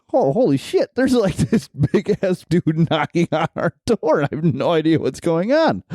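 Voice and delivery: shocked disbelief. Retold or imitated. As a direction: replay the shock at the peephole, amused. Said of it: "I'm like".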